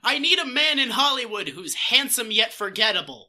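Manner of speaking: loud, brassy casting-director voice, slowing at the end. Retold or imitated. Mocking a studio boss seeking forgettable handsome men, loud and brassy. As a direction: boom like a studio boss hunting extras. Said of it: "Hollywood casting director voiced".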